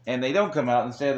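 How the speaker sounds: ordinary talk, nothing marked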